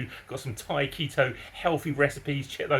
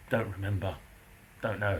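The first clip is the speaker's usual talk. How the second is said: mumbling neighbour; 5 semitones lower, 6 dB quieter than usual. Retold or imitated.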